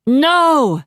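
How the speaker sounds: loud, high acted cry of "no"; shouted. A narrator's reading. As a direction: shout it out in desperate protest. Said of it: "Robbie shouted".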